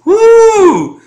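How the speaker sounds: high, loud drawn-out woo; whooping at a bold line; excited whoop. Real, not acted. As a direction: let out a piercingly high whoop. Reacting to the quoted threat.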